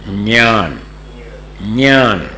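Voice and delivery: ज्ञान said as "dnyaan", starting with d-ny; ज्ञान the Maharashtra way, "dnyaan"; ज्ञान pronounced "dnyaan"; Marathi pronunciation. Shown as a demonstration. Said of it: "और महाराष्ट्र वाले".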